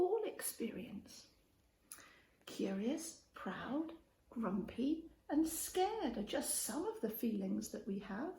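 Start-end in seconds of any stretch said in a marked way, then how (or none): none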